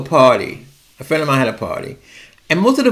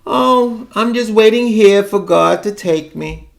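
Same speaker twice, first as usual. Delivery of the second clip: put-on higher, mournful old aunt on the phone; mock sad old aunt; 7 semitones higher than usual. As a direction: sigh it out like a weary old aunt.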